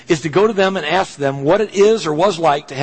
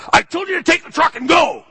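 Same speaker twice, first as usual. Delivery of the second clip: angry dad shout; 14 semitones higher than usual. Retold or imitated. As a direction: shout it like an enraged father. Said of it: "first words out of his mouth".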